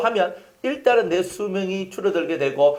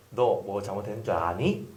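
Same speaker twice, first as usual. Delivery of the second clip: put-on soft, unhurried question to a child; calm gentle question; 10 semitones lower, 8 dB quieter than usual. Shown as a demonstration. Pastor showing parents how to question a child, soft and calm. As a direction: ask it gently, like a patient parent. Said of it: "라고 했어요".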